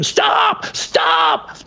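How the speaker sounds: very high screamed stop; frantic high shout. Retold or imitated. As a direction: yell to stop the bus. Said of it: "I scream".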